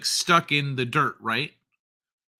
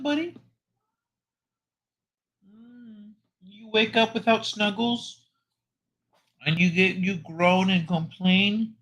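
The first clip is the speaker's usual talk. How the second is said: pet talk; 7 semitones higher than usual. Real, not acted. Owner coaxing a napping pet for snuggles, high pet talk. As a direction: coo gently up high, as to a pet.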